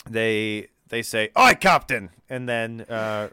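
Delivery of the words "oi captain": loud, high shout of Oi, Captain!; shouted call. Acted. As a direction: bellow Oi, Captain! across the deck. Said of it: "I feel like they'd say".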